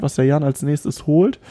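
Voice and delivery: ordinary talk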